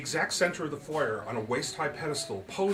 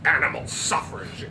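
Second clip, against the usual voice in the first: muttered scorn; 2 semitones higher, 7 dB louder than usual. Acted. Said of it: "Andrew said, staring down at his feet".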